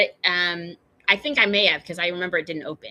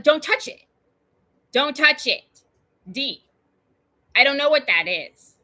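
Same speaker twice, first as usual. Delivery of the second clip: louder, higher, firm command; urgent warning; 5 semitones higher, 4 dB louder than usual. Retold or imitated. Stepparent warning D off the oozing rock, urgent and firm. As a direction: warn the child off sharply.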